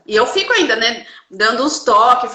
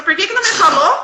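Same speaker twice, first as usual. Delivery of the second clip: quick, higher, mock-indignant question; mildly cross; 9 semitones higher, 4 dB louder, faster than usual. Daughter scolding her mother, mock indignant question. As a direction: toss it out as a half-annoyed complaint. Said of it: "Aí ela ficou brava".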